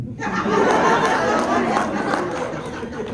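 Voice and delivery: high unvoiced laugh; burst of laughter. Real, not acted. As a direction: break into laughter. Laughs after his impression.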